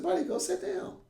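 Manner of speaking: teacher waving a student off to the bench; high coaxing teacher. Retold or imitated. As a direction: humour the kid, lightly. Teacher answering kid gently.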